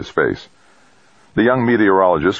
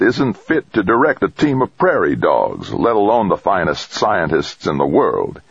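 angry rant; 8 semitones higher than usual. A narrator's reading. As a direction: rant with indignant contempt. Trumbull rants about his son.

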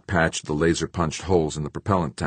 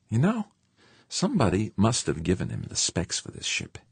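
high-pitched character voice, musing; George musing aloud; 7 semitones higher than usual. A narrator's reading. Spaceman works out who leaked the ship's specs, musing aloud. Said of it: "George mused aloud".

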